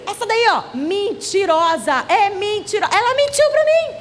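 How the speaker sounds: put-on indignant childish accusation, pitch climbing; upset child protesting, high and stretched; imitated angry child. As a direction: tattle on her in a high wail. Retold child accusing his mother.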